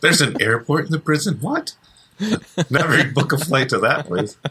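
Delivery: high, disbelieving squeal; incredulous. Real, not acted. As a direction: rise way up on What. Mock disbelief at prison airport.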